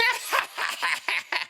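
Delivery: breathy cackle. Acted. Rocket laughing at own joke.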